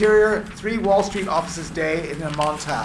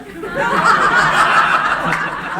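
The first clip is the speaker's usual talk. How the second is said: loud laugh; 8 dB louder than usual. Real, not acted. Reader laughs at scene.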